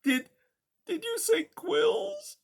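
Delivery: high, slow, halting mouse voice; frightened mouse stammers to porcupine; stammering. Acted. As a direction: stammer it out in shock. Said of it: "she stammered".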